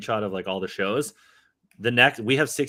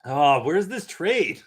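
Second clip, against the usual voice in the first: very slow whine for the trade; mock exasperated moan; drawn-out impatience; 5 semitones higher, much slower than usual. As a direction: stretch it out impatiently.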